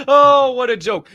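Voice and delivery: high, loud, coming off a laugh; loud mock-amused exclamation; mock amusement. Follows exaggerated laugh, mocking.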